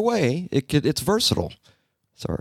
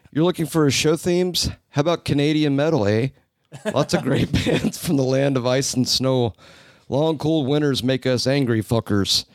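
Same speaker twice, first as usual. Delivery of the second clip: higher-pitched, put-on Canadian accent; 4 semitones higher than usual. Host reading a Toronto listener's message, put-on Canadian accent. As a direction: read it out as a chirpy mock Canadian. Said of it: "read this message in the accent that I think C J".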